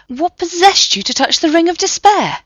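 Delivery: lightly voiced professor, high and out of breath; a hint of the flustered old professor; breathless professor. A narrator's reading. Professor scolding the men who touched the ring, breathless. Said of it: "gasping for breath".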